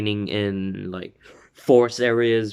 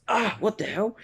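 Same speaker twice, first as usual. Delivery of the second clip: retold cry of shock, pitched way up; startled outburst; 12 semitones higher than usual. Fan retelling a fighter getting hit, mock startled yelp.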